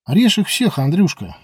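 loud, high, gleeful egging-on; cheerful. A narrator's reading. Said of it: "весело посоветовал Нур". (весело, задорно и громко)

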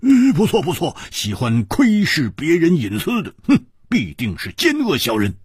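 high-pitched emphatic outburst; loud agreement. A narrator's reading. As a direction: agree loudly with a scornful edge. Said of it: "蝴蝶花大声说". (高声附和)

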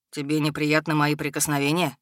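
low, concerned frown; frowning dragon. A narrator's reading. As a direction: ask it with a frown. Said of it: "Нахмурившись". (нахмуренный низкий голос дракона)

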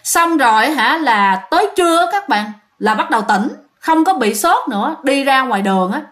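ordinary delivery, nothing marked

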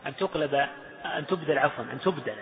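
ordinary talk

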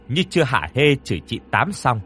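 ordinary delivery, nothing marked

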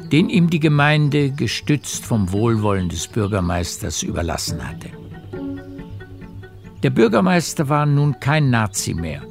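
ordinary delivery

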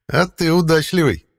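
high, loud cat voice. Acted. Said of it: "сказал кот".

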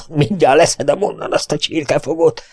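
ordinary delivery, nothing marked

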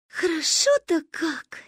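put-on high, slow sleepy girl's whisper. Manka whispers in half-sleep, high and slow. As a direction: breathe it out, blissfully drowsy. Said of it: "шепнула Манька".

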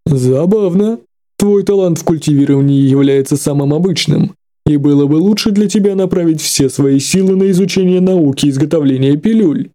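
a lightly voiced stern old master; stern old elder. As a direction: lecture the boy with disdain. Old elder lecturing the youth.

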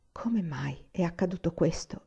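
whispered, hushed question; stunned whisper. Acted. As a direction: breathe it out in disbelief.

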